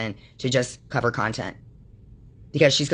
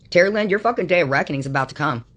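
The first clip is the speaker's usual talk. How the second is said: ordinary talk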